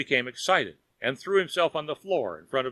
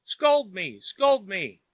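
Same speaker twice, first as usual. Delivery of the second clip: louder, urgent plea; crying out; 5 semitones higher, 4 dB louder, much slower than usual. A narrator's reading. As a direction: grab his legs and cry it. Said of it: "crying out".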